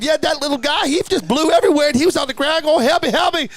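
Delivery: very high, animated mimicked accent; Keith West's accent. Witness recounting the crash, in Keith's high accent. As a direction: push his accent high, ending on a mock plea. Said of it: "he's got that accent".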